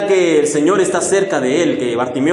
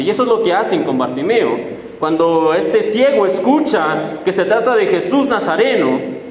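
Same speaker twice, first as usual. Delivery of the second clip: ordinary talk, nothing marked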